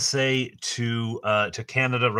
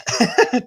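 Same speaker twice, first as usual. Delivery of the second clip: high embarrassed laugh; 6 dB louder than usual. Real, not acted. Sheepish about arriving late.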